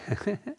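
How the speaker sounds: the programmed giggle again; quiet fake robot laugh; canned robot laugh. Robot laughs a third time.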